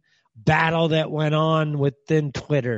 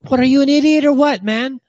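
higher, louder, fast angry yell; angry outburst; 11 semitones higher, 6 dB louder than usual. Real, not acted. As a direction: snap at her in disbelief. Ranting at press secretary.

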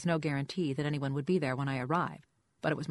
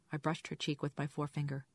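plain reading voice

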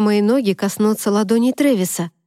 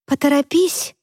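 sharp, higher hurry-up call; прикрикнула; 6 semitones higher than usual. Acted. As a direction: bark it out to hurry her. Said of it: "прикрикнула на меня Америка". (нетерпеливый окрик)

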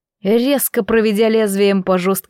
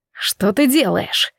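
usual speaking voice

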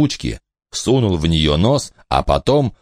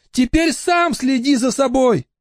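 mother calling. Acted. Mother calls up.

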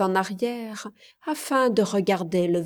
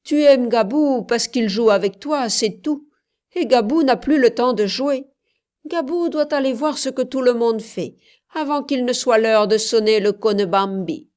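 put-on loud, higher lecturing of a child; brisk scolding; 4 semitones higher, 7 dB louder than usual. A narrator's reading. Commandeur sending the little girl away, loud scolding. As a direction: send the child off with emphatic reproach.

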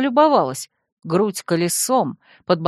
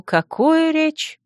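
high, slow sarcastic repeat; 9 semitones higher, much slower than usual. A narrator's reading. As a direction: drag out какую речь high and mocking. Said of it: "саркастично приподнял он белоснежные брови".

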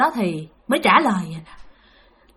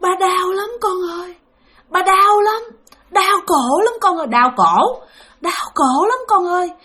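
pleading spirit father-in-law; 8 semitones higher than usual. Retold or imitated. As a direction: beg a daughter-in-law for rescue. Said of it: "Nói với mẹ bạn vậy nè".

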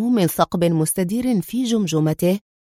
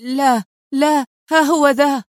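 cry of terror and pain; 6 semitones higher, 4 dB louder than usual. A narrator's reading. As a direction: give in howling with pain and fear. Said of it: "صرخ جان في رعب وألم".

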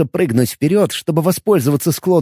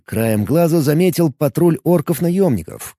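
ordinary delivery

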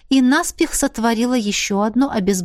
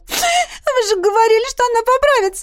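very high-pitched tearful pleading; sobbing princess; 3 dB louder than usual. A narrator's reading. Princess pleads with healer for her sister, sobbing. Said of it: "рыдающую принцессу".

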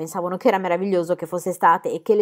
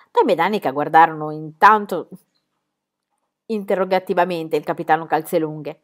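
ordinary delivery

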